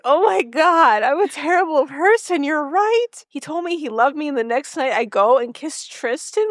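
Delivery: high, anguished self-blame; a lightly hammed-up wail of regret; panicked Rory. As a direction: squeak out the guilt like a mock meltdown. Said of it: "And Rory's like".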